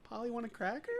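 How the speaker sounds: put-on high, soft little kid voice; softly put-on high child's voice; kid impression. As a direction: imitate a small kid, high-pitched and quiet. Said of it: "just this kid being like".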